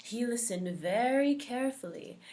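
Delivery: ordinary delivery, nothing marked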